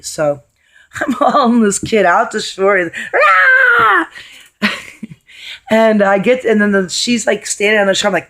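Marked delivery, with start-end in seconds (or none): 3.1-4.1 high, loud imitated shriek of the kid; mock scream. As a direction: let out a sudden piercing yelp. Hauling screaming kid ashore.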